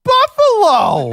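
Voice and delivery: high, drawn-out laughing shout of Buffalo; delighted laughing whoop; laughing cheer. Real, not acted. Mock cheer for hometown team.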